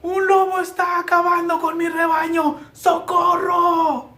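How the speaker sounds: high, drawn-out cries for help; loud, high, stretched-out alarm call; frightened shouting. A narrator's reading. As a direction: scream for help as the scared shepherd boy. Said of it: "corrió en busca de ayuda gritando".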